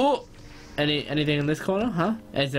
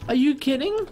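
high, rising question in disbelief; incredulous; 12 semitones higher than usual. Real, not acted. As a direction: draw it out, pitch climbing in disbelief. Disbelief after losing the kill.

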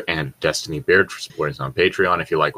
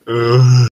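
pained groan; 4 semitones higher, 9 dB louder than usual. Real, not acted. Groan of pain, hip locked.

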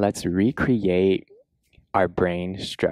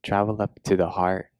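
guided meditation; 4 dB quieter than usual. Acted. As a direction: guide them gently into a body scan, unhurried.

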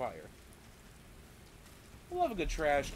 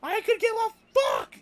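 shouting in frustration; 10 dB louder than usual. Real, not acted. Ranting about royal family.

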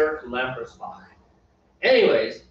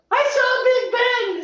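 put-on high, loud, excited child voice; thrilled kid; 5 dB louder, slower than usual. Parent imitating their kid after seeing Big Ben, thrilled. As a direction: squeal it like a delighted kid. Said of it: "my kid was still thrilled".

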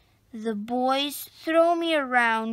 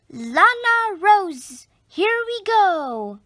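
put-on excited child calling; 6 semitones higher, 6 dB louder than usual. Little girl calls to her rabbit on the carousel, loud and high. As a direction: call out to the bunny as the ride starts. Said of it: "Clementine says".

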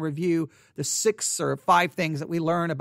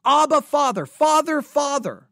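much higher, louder earnest calling; a preacher showing a forceful cry to God; 8 semitones higher, 7 dB louder than usual. Shown as a demonstration. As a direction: press each Father harder, like a plea. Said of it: "it's forceful".

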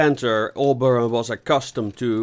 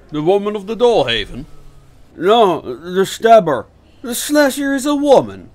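higher, louder in disbelief; surprised; 10 semitones higher, 9 dB louder than usual. Acted. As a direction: caught off guard, incredulous.

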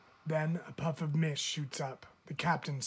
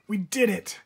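higher, louder joyful cry; triumphant cheer; 6 semitones higher, 8 dB louder than usual. Acted. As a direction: cheer like you've just saved her. Divers cheer the rescue.

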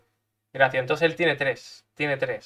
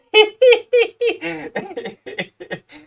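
high laugh; 7 dB louder than usual. Real, not acted. Laughing at the enemy crying.

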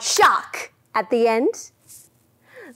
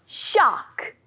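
loud shark; 3 semitones higher than usual. Shown as a demonstration. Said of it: "big loud shark".

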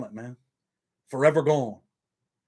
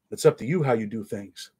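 usual speaking voice

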